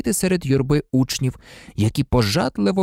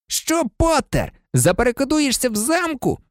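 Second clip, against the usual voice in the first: higher mocking schoolboy call across the hall; taunting call; 11 semitones higher than usual. A narrator's reading. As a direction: jeer at him from the queue. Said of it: "крикнув Мелфой".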